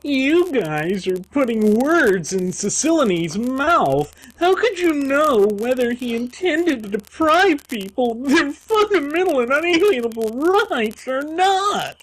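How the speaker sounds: high, put-on accusing voice; hammed-up outrage, pitch climbing to the end; mock indignant socialist. Cynical socialist pointing the finger at the hosts, mock indignation. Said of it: "cynical utopian socialists who are going to point the finger".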